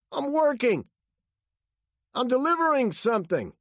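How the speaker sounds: very high, loud protest; frightened high-pitched yell; panicked answer. A narrator's reading. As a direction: explain yourself in fright. Frightened driver answers at gunpoint.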